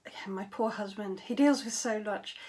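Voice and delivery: ordinary talk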